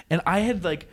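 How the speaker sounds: normal talking voice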